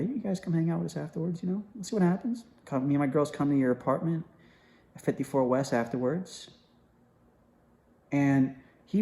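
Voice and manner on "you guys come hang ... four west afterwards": soft, quick, mock-seductive invitation. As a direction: imitate a flirty come-on, kept quiet. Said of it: "in a real seductive way".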